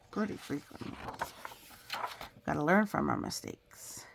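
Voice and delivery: plain reading voice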